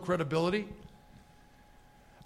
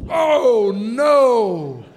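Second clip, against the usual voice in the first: mock horror; 8 dB louder than usual. Big passenger squeezing into center seat, mock horror. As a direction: wail it loudly, pitch arching and falling.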